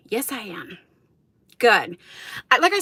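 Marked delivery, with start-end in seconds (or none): 0.1-0.8 breathy self-mocking whisper under her breath; whispered aside. Real, not acted. Admitting to viewers she is out of shape, in a whisper.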